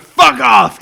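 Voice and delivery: very loud, punched-out yell; mock shout. Shown as a demonstration. Answering every phone call, hammed-up shout. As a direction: bellow it as a phone greeting.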